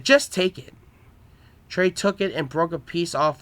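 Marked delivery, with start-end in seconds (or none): 0.0-0.7 mildly annoyed insistence, pitch raised. A narrator's reading. Trainer offering half a sandwich to proud Trey, mildly annoyed. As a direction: push the offer with a touch of irritation. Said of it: "I got annoyed and said".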